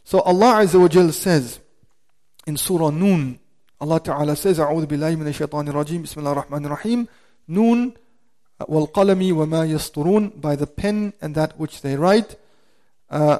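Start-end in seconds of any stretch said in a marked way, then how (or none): 4.6-10.4 quick, rhythmic recitation of the verse; Quran recitation. Acted. Imam reciting Quran to the congregation, quick and rhythmic.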